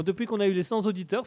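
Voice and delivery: ordinary delivery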